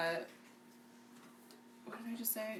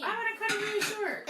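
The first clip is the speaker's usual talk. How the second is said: put-on high childlike voice, a bit louder; imitating her daughter; 12 semitones higher, 4 dB louder than usual. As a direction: mimic a kid's big announcement. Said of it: "she says".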